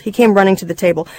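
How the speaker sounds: ordinary delivery, nothing marked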